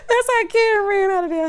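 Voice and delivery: high, loud laugh into the words; real howl of laughter; shrieking laugh. Real, not acted. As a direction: laugh through the line. Cracks up at own joke.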